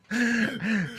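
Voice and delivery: a sudden high laugh at his own joke; high burst of laughter. Real, not acted. Laughing at his prank on Mike, high laugh.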